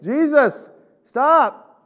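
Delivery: high, loud drawn-out call; blind man yelling. Retold or imitated. Blind man calls to Jesus over the crowd, high, loud yell. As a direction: call out to stop him passing by. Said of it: "this blind man is yelling at Jesus".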